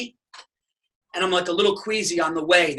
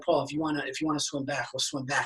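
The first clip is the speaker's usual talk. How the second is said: much softer, gentle reassurance; soft reassuring wife; 3 semitones lower, 8 dB quieter than usual. Retold or imitated. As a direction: hush it kindly to a panicking husband. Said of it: "Stacy's like".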